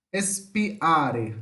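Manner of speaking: "Espiare" broken apart between the i and the a; separated hiatus. Shown as a demonstration. Teacher showing students a hiatus, separated vowels. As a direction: say "Espiare" deliberately, i and a as separate syllables. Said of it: "aqui demos uma ênfase".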